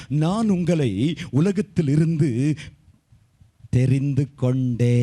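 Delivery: slow, stretched-out solemn quote; scripture declaration. Retold or imitated. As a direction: proclaim the verse slowly, drawing out the endings. Quotes the Lord's words from scripture. (வசனத்தை நிதானமாக இழுத்து அறிவிப்பது)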